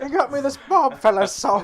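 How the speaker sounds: high British woman, laughing; scratchy high lady voice cracking up; Patty Boyd parody. Pretend. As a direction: do a high-pitched Englishwoman through giggles. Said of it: "high-pitched, scratchy British woman voice".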